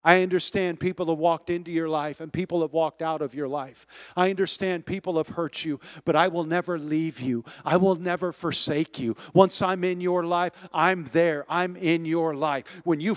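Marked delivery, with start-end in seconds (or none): none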